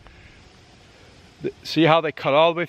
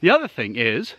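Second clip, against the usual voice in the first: winded, panting a little; out of breath; 4 semitones lower than usual. Real, not acted. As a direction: still catching breath. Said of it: "wow, I'm out of breath".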